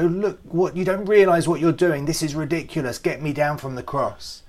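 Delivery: loud, indignant protest from the cross; hammed-up outraged grumble; cross complaint. Jesus imagined griping loudly at the soldiers, indignant. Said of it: "he doesn't get cross".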